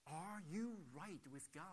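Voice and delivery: a light Southern American drawl on the question; southern accent. Retold or imitated. Evangelist leaning over to the talk show host, in a soft Southern accent. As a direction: ask it gently in a Southern accent. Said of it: "In his southern accent".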